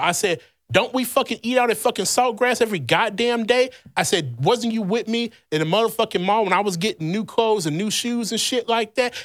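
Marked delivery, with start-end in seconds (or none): 0.7-3.7 higher, scornful telling-off; reliving his angry snap back; retold furious retort, pitched up; heated comeback. 4.4-9.1 higher, scornful telling-off; reliving his angry snap back; retold furious retort, pitched up; heated comeback.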